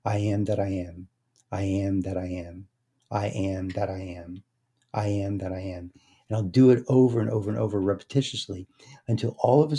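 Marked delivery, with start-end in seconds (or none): 0.0-5.9 repeated mantra. Shown as a demonstration. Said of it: "simply start repeating".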